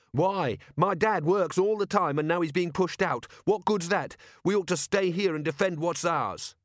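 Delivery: put-on high, indignant teenage boy; angry boy voice. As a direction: argue back like a defiant lad. Boy argues with Carrot.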